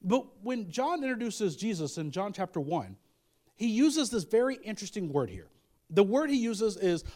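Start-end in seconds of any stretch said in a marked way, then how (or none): none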